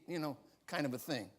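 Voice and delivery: ordinary talk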